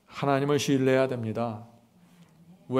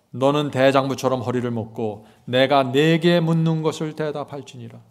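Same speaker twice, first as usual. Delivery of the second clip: slow, louder proclaimed verse; slow proclaimed Bible verse; scripture reading; 5 dB louder than usual. Acted. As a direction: stretch the words in rebuke.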